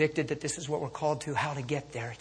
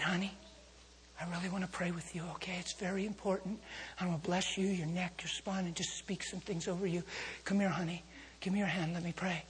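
tender comforting; 8 dB quieter than usual. Retold or imitated. Comforting injured lady after crash.

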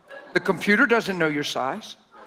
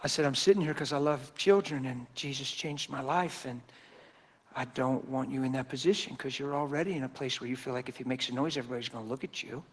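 calm reassurance; 4 semitones lower, 8 dB quieter than usual. Retold or imitated. Calm reply to nervous mother.

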